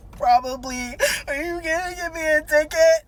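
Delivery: hammed-up weepy question, rising high; mock tearful whine. Sobbing driver asks cop about ticket, mock crying. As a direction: whine it through pretend tears.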